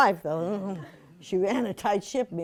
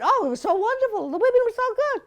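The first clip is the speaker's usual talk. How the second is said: high, fast society lady; high gushing socialite impression; Liz's voice; 12 semitones higher, faster than usual. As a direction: gush like a theatrical grande dame. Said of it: "And so Liz said".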